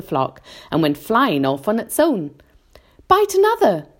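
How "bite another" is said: high, loud, brief command; giving an order. A narrator's reading. James orders centipede to cut another string, urgent order. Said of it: "James ordered".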